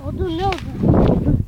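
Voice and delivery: loud, slow disbelieving question; surprised shout. Real, not acted.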